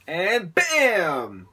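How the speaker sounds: loud, drawn-out BAM for the impact; storyteller's dramatic impact word; sudden bam. Retold or imitated. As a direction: punch out the hit, stretching the word. Story's sudden bird strike.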